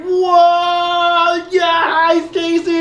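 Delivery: high, sing-song starstruck squeal; swooning over a celebrity; gushing fan voice. Retold or imitated. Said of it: "they're like".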